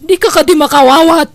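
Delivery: loud, fast shouted orders; shouting. Acted. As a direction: bark commands at the guard over the music. (agpukpukkaw a mangibilin iti guardia)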